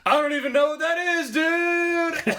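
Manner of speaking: high, loud, fast surfer dude; Crush voice. Retold or imitated. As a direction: ham up a spaced-out surfer dude. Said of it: "Crush goes".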